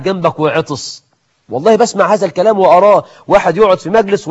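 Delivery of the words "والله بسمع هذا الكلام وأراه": ordinary delivery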